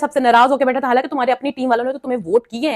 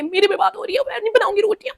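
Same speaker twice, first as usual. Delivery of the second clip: much higher, hammed-up indignant housemate; mock offended outburst; 9 semitones higher than usual. Tania refusing to make rotis, storming off in a high, mock-offended outburst. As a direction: play her taking it personally and storming out.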